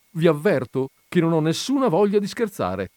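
high, offended warning; indignant protest. A narrator's reading. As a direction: warn him off indignantly. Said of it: "lo fissò indignata". (avvertimento indignato)

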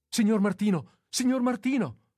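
high, loud urgent call; calling for help. Acted. Nurse calling the young man awake, high loud alarmed cry. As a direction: shout his name through the wall to wake him.

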